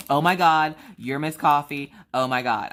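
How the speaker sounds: loud, starstruck imitation of a fan; mock fan freak-out. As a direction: ham up a fan losing it. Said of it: "have that moment of freak out".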